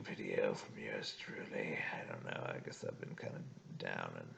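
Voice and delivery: drowsy and fading; nearly asleep, words trailing; sleepy. Real, not acted. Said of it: "obviously I'm falling asleep right now".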